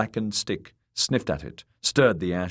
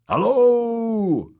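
loud high call down into the dark; 6 dB louder than usual. A narrator's reading. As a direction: shout down the steps. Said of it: "he called again".

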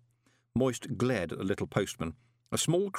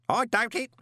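high, loud, cheerful bellow; 13 semitones higher, 3 dB louder than usual. A narrator's reading. Parker cheerfully bellowing about his late spouses. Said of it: "bellowed cheerfully".

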